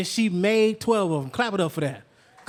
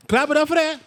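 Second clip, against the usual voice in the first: louder, higher rallying cry for applause; louder hype call; 6 semitones higher, 5 dB louder, faster than usual. Acted. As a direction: pump the crowd up to clap. Host rallying applause, repeated louder.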